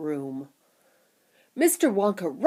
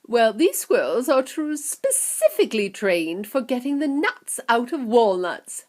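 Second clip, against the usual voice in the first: a hint of eager Wonka explaining; lightly voiced showman explaining; 5 semitones higher, 6 dB louder than usual. Wonka explaining the squirrels, lightly voiced. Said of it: "Mister Wonka explained".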